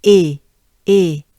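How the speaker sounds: short, loud French "e", a closed ay; crisp, loud closed é for "e"; e up in mouth. Shown as a demonstration. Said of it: "up in your mouth".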